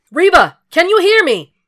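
high, loud yell into the flames; calling out. A narrator's reading. Will searching for Reba in the fire, loud desperate call. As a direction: shout it across a burning room.

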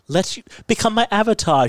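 Taweret. Retold or imitated. Taweret asking Layla to be her avatar, lightly acted. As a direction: lift the voice high for a coaxing request. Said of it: "Taret's like".

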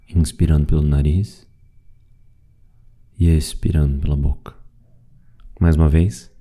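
calm, even meditation voice with long pauses; guided meditation. Acted. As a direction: guide them gently through the breathing, leaving space after each phrase. (meditação guiada, voz calma e pausada)

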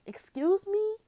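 high, loud "Excuse me?" in disbelief; shocked and insulted; offended surprise. Real, not acted. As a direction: react as if you can't believe it. Outraged reaction to crude message.

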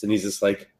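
usual speaking voice

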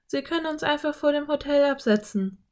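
put-on high-pitched girl character; eager girl. A narrator's reading. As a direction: voice a keen girl, pitched well up. Narrator voices the girl Anne.

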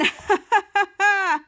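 bright burst of laughter after the recap; real laugh. Real, not acted. Host laughing after finishing the scene in a burst of laughter. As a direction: laugh at your own performance.

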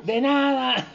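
high sing-song holler; drawn-out high call; shouted reply. Acted. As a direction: toss the answer back across the courtyard. Acted neighbour yelling back.

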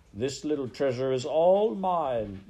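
high, loud, swooping claim; calling out possessively. A narrator's reading. Rat claiming his treasure before the pack, calling out possessively. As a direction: swell loudly on "all mine". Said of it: "Botticelli called out to the other rats".

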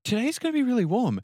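very high mocking voice; prissy high-pitched mock worry; mimicked Sydney locals. Mimicking Sydney people fretting about warmth.

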